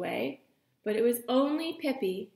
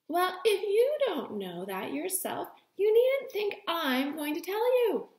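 high, indignant reply; astonished; 8 semitones higher than usual. Acted. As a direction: answer in astonished disbelief. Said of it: "astonished and dismayed".

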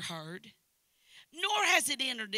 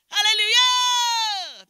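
loud, high, long-held hallelujah wail; worship cry on request; 11 semitones higher, 17 dB louder, much slower than usual. Shown as a demonstration. Singer showing her stage cry for the preacher, wailed shout. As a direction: belt it out, swelling and falling. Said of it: "Do it again".

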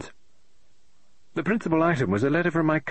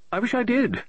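high, fussy woman's voice, rising in exasperation; an eccentric lady author tearing her hair; fretting novelist; 5 semitones higher than usual. Acted. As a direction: novelist complaining aloud about her plot, high and exasperated. Mrs Oliver wishes she drank.

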